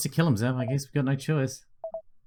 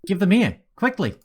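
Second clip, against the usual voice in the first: urgent plea; 7 semitones higher, 3 dB louder than usual. Acted. Ray desperate for the pills.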